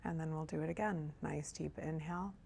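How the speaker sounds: soft, hushed meditation guide with long pauses; guided meditation. Real, not acted. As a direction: ease them gently into breath and body, softly. Leads a meditation.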